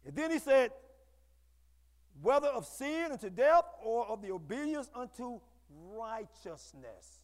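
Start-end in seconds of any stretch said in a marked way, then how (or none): 2.2-7.2 slow scripture reading. Shown as a demonstration.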